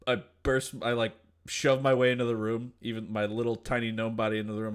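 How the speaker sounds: normal talking voice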